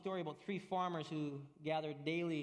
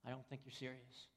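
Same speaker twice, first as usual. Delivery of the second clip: much softer and lower, quietly unconvinced; quiet doubting stranger; 5 semitones lower, 10 dB quieter, faster than usual. Retold or imitated. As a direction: shake your head and murmur it. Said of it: "the outsider looks at them and shakes his head".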